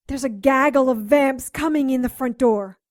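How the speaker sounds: loud urgent warning; alarmed warning. Acted. Warning her partner that vampires are at the front door, loud. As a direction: warn him the vamps are here.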